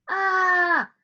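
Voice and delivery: short high pained yelp; ouch cry. Acted. Hand yelps at the sharp shot, high ouch. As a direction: yelp as the needle goes in.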